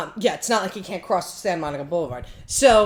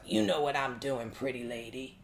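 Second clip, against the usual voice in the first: creepy low voice; 8 semitones lower, 11 dB quieter than usual. Retold or imitated. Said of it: "I heard the voice go".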